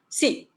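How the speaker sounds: "Sí" short with a sharp fall; curt falling affirmative "Sí"; short, abrupt yes. Shown as a demonstration. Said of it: "inclusive poco abrupto".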